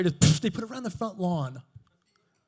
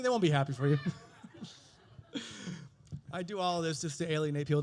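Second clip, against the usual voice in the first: ordinary talk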